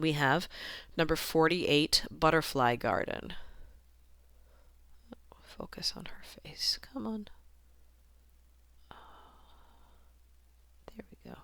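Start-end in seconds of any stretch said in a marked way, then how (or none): 5.4-11.4 very soft, half-whispered aside; muttering to self. Real, not acted. Coaxing the camera to focus on her face, muttering. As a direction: mumble it under your breath.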